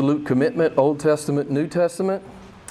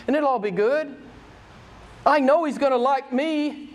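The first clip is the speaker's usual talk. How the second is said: higher-pitched, self-satisfied rich man; pitched-up, smug rich young ruler; 7 semitones higher than usual. Acted. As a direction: play him sure of his own importance.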